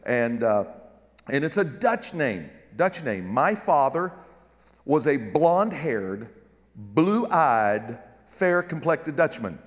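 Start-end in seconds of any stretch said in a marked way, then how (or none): none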